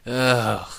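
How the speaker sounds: loud drawn-out ugh of dread; disgusted groan. Real, not acted. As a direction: groan like you already regret it. Groaning at a grim fanfic.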